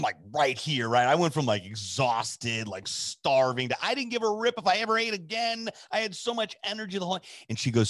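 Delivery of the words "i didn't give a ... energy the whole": high-pitched, energised rush; buzzing excitement. Real, not acted. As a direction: tell it as if wired with energy. Describes being wildly energised.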